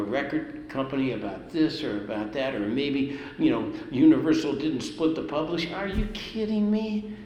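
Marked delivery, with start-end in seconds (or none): 5.7-7.2 incredulous. Real, not acted. Incredulous at the idea of complaining.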